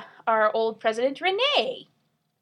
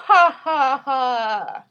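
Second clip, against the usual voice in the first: sneering panto villain; 6 dB louder, much slower than usual. Pretend. Mock evil sign-off laugh.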